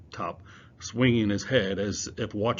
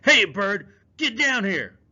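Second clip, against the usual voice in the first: loud, high shout upward; chasing shout, much higher and louder; calling out; 11 semitones higher, 9 dB louder than usual. Acted. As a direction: yell at the bird to come down. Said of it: "he called".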